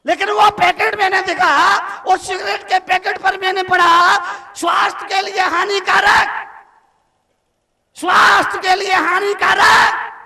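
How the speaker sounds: loud and high, hammering each word; thundering preaching. Acted. Monk shouting the packet warning at his audience. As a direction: shout it like an alarm.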